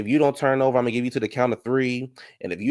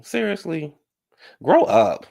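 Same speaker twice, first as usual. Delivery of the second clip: high-pitched disbelief into a louder telling-off; exasperated scolding; 6 semitones higher, 3 dB louder, slower than usual. Acted. Vlogger telling an absent show contestant to grow up, exasperated. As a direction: scold with incredulous impatience.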